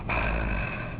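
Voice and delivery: plain reading voice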